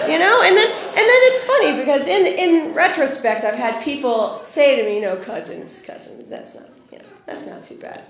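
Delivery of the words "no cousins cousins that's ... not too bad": very soft, near-whispered excuse; hushed reassurance. Retold or imitated. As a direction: imitate people awkwardly playing it down under their breath. People excusing cousins marrying.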